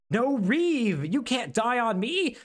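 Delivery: high, hammed-up deathbed plea; mock anguish. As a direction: wail it like a melodramatic hero. Acted cry over dying friend.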